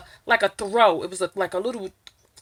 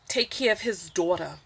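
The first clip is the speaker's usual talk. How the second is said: ordinary talk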